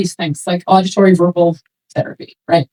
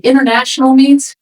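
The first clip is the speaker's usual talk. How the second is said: ordinary talk, nothing marked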